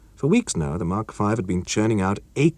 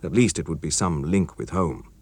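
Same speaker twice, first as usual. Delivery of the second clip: plain reading voice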